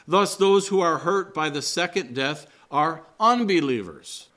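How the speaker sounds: ordinary talk, nothing marked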